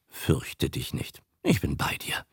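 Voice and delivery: low, hushed comfort; calm the frightened child at your side; a man soothing Franzi in a near whisper; soft reassurance. Acted.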